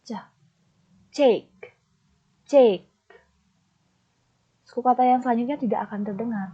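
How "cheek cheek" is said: cheek loud with a long falling vowel; stressed cheek. Shown as a demonstration. Teacher drilling students on stressed cheek, loud falling. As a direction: stretch and punch cheek, louder. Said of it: "kalian perjelas adalah kata".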